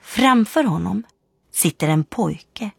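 ordinary delivery

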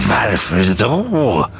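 very slow, swooping outraged echo; drawn-out incredulous screech; shrieked. A narrator's reading. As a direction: throw the question back, stretched into a shriek. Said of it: "skrek den grå mannen".

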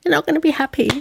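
high mock warning from the sisters; sisters' warning, mimicked high. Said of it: "my sisters telling me".

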